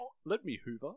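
high, half-hearted mock British; bad British accent. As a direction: attempt a clichéd British accent. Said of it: "do a British accent".

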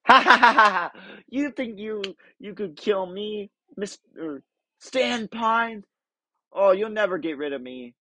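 hammed-up villain laugh and taunt; Bill Cipher impression. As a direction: gloat like a triangle demon. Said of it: "I can't do his voice, but that's what Bill Cipher from Gravity Falls sounds like".

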